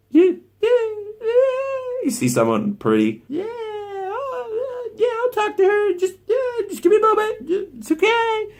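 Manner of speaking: very high, strained falsetto with whimpering cries; mock squeaky freak-out. Mocking a desensitized guy who sees someone pretty, whimpering falsetto. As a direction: squeal like a guy falling apart at a pretty girl.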